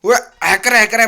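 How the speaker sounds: loud mocking cry of hacker; mock accusing shout. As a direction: yell it like a sore loser. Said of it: "नंतर म्हणायचं नाही". (चिडवत ओरडून म्हणणे)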